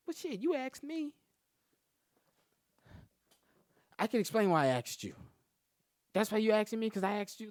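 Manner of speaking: put-on high and loud whiny girl; mock girl voice. The girlfriend arguing back in a high whine.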